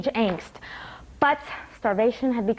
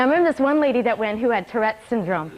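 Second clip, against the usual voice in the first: ordinary talk, nothing marked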